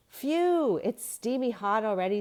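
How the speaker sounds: high, loud sigh of heat; hot and puffing. A narrator's reading. Girl on the beach complaining to friends, loud and puffing. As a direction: puff out the heat like a kid on a hot day. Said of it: "said Sue".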